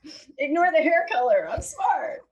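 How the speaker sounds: high, loud mock airhead voice; ditzy blonde voice. Mocking her own dumb-blonde image, high airhead voice. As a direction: play the dumb blonde insisting she's smart. Mocking how she sounds to others.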